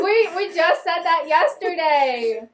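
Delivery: drawn out, high, exaggerated whine; exasperated teasing. Real, not acted. Friend teasing her for repeating yesterday's talk, mock exasperated whine. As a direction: stretch it out in mock disbelief.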